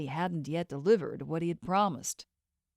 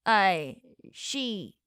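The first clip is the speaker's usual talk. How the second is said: stuttering. A narrator's reading. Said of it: "Bartley stuttered".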